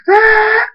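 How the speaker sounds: very high, loud scream. A narrator's reading. Thunderstorm screams as daughter is taken, high scream. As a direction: burst into a piercingly high scream. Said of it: "Thunderstorm screams".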